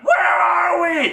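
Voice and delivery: high, loud, slow panicked yell; shrill-free frantic cry of confusion; panicked shout. Retold or imitated. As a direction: scream it like a lost crash survivor. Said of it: "who went".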